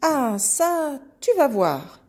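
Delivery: loud, indignant outburst; threatening pig. Acted. Pig bursting out after the little goat, loud angry threat. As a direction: threaten it loudly, starting high.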